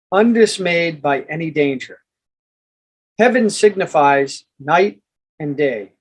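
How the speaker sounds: measured, emphatic audiobook narration; formal book reading. Acted. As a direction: read it out like a solemn treatise, pausing between phrases. Reads book chapter aloud.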